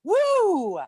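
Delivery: high whooping cheer; excited cheer. Real, not acted. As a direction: let out a big woo. Cheering for her mentor.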